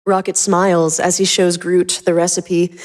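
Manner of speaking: ordinary delivery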